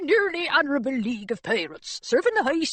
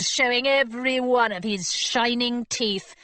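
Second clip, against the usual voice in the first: plain reading voice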